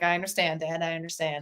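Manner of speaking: soft, low acceptance; mock resignation. Answering dad's threat to disown, quiet mock resignation. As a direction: drop it down quietly, as if giving in.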